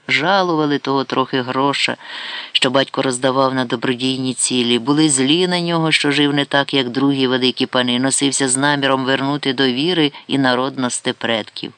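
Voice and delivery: ordinary delivery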